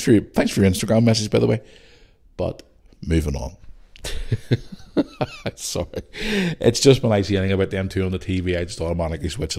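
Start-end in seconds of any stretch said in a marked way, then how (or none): 4.0-5.5 high laugh. Real, not acted. Laughs after rant.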